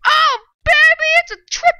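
high, shouted celebration; squealing victory call; hyped cheer. Pretend. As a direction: brag about a triple kill. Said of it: "I would have said".

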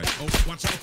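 sudden loud high warning; warning shout. Real, not acted. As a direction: yell to warn a teammate. Warns teammate of danger.

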